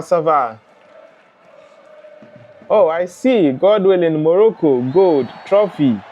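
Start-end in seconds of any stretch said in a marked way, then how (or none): none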